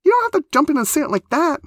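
very high, loud protest; aghast, high-pitched outcry; horrified. A narrator's reading. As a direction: object in mortified horror.

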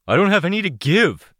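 high, loud refusal; strained denial. Acted. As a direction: push back, voice straining. Mercenary refuses again.

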